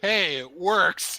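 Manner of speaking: loud call. Real, not acted. Calling out in jest.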